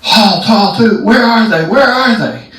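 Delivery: high-pitched calling out; searching guards' shouts. Retold or imitated. MPs search for the hidden intruders, high urgent shouts. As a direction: imitate guards hollering in pursuit. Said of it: "You can hear the M Ps".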